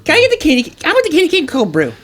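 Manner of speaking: very high, mocking little-kid voice; gleeful child. Friend mocking a coffee order, gleeful child voice. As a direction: squeal the order like a delighted child. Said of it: "you said it with such glee, like a child".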